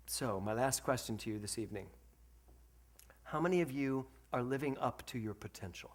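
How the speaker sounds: ordinary talk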